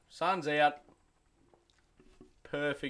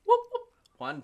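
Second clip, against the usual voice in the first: mock whoop; 6 dB louder than usual. Joke, Superman imitating quick thrusts.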